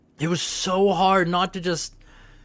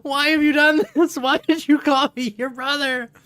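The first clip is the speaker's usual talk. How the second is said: exasperated mom; 6 semitones higher than usual. Retold or imitated. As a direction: question the child in disbelief. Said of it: "It's like".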